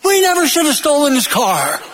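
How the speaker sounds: half-scream it as a joke punchline; mock scream. Back-seat passenger waking to a policeman, mock scream. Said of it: "He screams".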